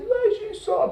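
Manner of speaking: high-pitched mimicry of another student; imitated classmate. Classmate tells her exam grade, imitated in high voice. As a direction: answer in a put-on high voice.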